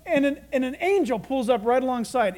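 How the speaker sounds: usual speaking voice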